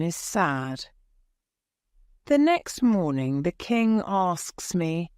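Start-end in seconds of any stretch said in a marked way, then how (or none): none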